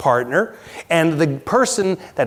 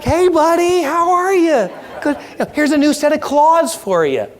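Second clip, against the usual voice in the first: much higher, hammed-up friendly hello; chummy greeting; 11 semitones higher than usual. Worker meeting Krueger the fifth time, chummy greeting. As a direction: greet him like an old pal, high-pitched. Acts out greeting the now-trusted scary man.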